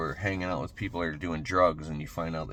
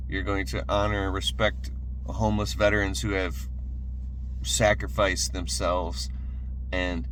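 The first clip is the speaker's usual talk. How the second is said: pumped-up sermon, louder and higher; rising motivational preaching; 3 semitones higher, 3 dB louder than usual. Acted. Motivational speaker urging viewers to act, fervent preaching. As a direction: fire them up like a preacher on a roll. Rallying viewers to change their lives.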